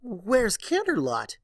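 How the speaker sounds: high, loud, slow horrified question; shocked to find the city gone; gasping alarm. Acted. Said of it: "she gasped".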